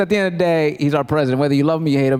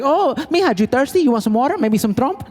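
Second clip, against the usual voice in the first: sweet scheming mom; 6 semitones higher than usual. Retold or imitated. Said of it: "She's like".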